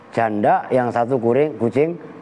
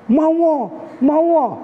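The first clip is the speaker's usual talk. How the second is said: eager widow cat; 13 semitones higher than usual. Acted. Preacher voicing the widow cat saying yes. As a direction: jump at the offer like the widow cat. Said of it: "Kata kucing janda".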